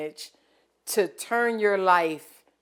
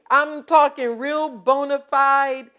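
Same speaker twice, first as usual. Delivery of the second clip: normal talking voice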